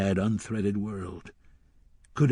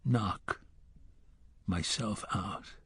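softer, with a long pause after "Knock" before "myself out"; the gunslinger slowly mulling over the boy's words; broken inner thought; 2 semitones lower, 11 dB quieter, slower than usual. A narrator's reading. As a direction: let "Knock" hang, then drop "myself out" softly. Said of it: "he thought".

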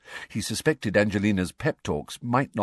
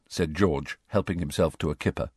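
usual speaking voice

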